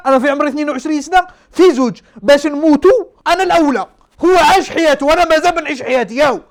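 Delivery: loud, high, emphatic arguing; indignant protest. Retold or imitated. Young patient arguing he should go before the old man, indignant. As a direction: push each point out hard and high. Argues who deserves to go first.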